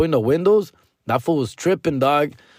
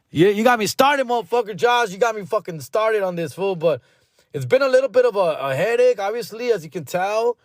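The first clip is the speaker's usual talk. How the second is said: higher, fired-up venting to Josh; ranting, voice pitched up in exasperation; worked-up rant; 9 semitones higher than usual. Real, not acted. Venting to Josh about the house build, higher and exasperated. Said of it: "I'm already on this rant".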